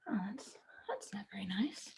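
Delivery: hushed whisper of disapproval. Real, not acted. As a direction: whisper it like a shared secret. Teacher reacts to unkind scene.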